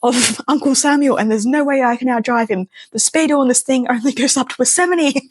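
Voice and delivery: imitating a friend in full panic; high frantic complaint, lightly played; freaking out. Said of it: "he is full on freaking out".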